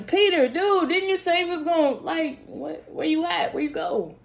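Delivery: high, casual mock-hurt pleading; hurt buddy asking where his friends went; Jesus as a disappointed buddy. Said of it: "he was asking his boys".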